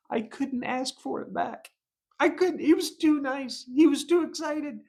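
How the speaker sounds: helpless squeaky protest; exasperated. Real, not acted. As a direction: voice climbing with embarrassment.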